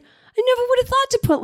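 very high, imitated wonder; impressed delivery guy. Furniture guy amazed by pink chair choice, impressed.

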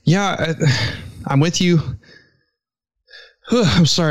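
breathless, catching his breath. Real, not acted. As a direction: talk while still panting a little. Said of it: "I'm out of breath".

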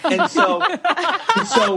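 high, giddy and laughing; excited laughing. Retold or imitated. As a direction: squeal it out with a laugh. Eager to find the host.

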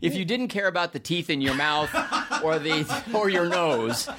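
laughing, high and amused. Real, not acted. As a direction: laugh through the joke. Joking reply, laughing heard.

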